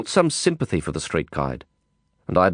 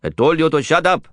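sharp, louder angry snap; snapping; 7 semitones higher, 5 dB louder than usual. A narrator's reading. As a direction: cut him off sharply. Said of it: "Anand snapped".

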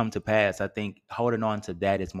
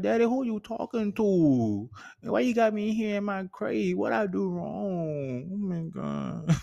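much higher, pleading puppy voice; high whimpering puppy begging its daddy; sad puppy voice; 12 semitones higher than usual. Retold or imitated. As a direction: whine like a sad little dog in a crate. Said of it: "He was like".

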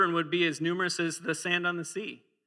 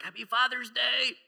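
put-on child voice; 8 semitones higher than usual. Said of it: "He's like".

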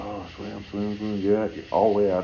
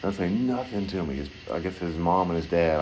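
ordinary talk

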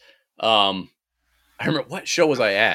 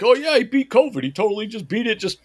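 mocking a fanboy; 5 semitones higher than usual. Host mocks Joe Rogan's fans, gushing dopey fan voice. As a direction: sneer at the followers by gushing like one. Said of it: "his idiot followers could be like".

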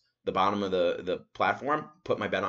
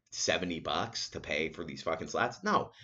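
ordinary talk

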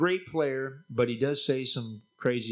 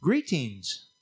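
angel voice; slower than usual. Retold or imitated. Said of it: "he said".

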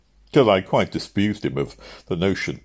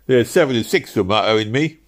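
raised, louder plea for money; pleading; 5 semitones higher, 6 dB louder than usual. Acted. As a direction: cabman pleading to be paid. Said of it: "he pleaded".

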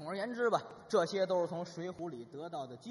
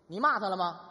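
a louder, hammed-up demand from an angry husband; angry question; 8 dB louder than usual. Husband grilling his wife about the thugs, mock angry. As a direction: push her for an answer, getting heated.

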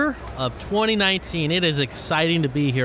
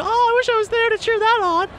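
much higher, whiny longing fan voice; mock wistful fan; 4 dB louder than usual. Ad mocks fans watching from home, wistful and much higher. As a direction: play the sad fan stuck watching TV.

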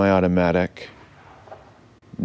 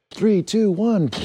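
much higher, ringing countdown; blast-off countdown; slower than usual. Acted. Captain counts the crew into blast off, high countdown. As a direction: count down high like a launch announcer.

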